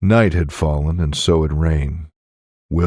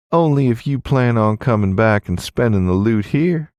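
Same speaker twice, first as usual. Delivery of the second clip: teasing innkeeper woman; 6 semitones higher than usual. Acted. Innkeeper woman flirts with guest.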